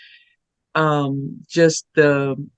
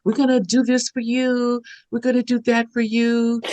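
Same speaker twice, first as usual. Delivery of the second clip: higher, pleading students; eager students; 8 semitones higher than usual. Retold or imitated. Students begging their teacher for publicity, higher and eager. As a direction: coax her like eager students. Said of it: "students coming out of the woodwork saying".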